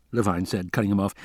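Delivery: ordinary delivery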